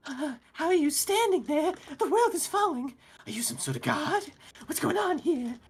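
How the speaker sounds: high, frantic, terrified goblin voice; a cornered goblin babbling in terror; panicked goblin. Acted. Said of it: "this goblin looks at you in horror and goes".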